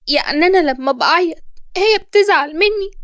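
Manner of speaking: put-on mild child voice, asking innocently; childlike. A narrator's reading. Boy asking his teacher about his mother, childlike. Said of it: "وقال بطفولة".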